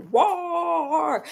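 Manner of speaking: high, drawn-out mock roar; rugido imitado. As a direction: roar like an enraged Dracula. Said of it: "That man was like".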